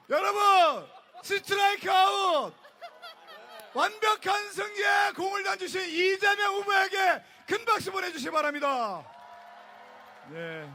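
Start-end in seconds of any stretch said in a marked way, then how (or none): 0.1-9.0 high, loud strikeout call; baseball caster. Acted. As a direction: roar the strikeout to the crowd. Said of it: "야구 캐스터처럼". (높고 크게 외치는 스트라이크 아웃 중계)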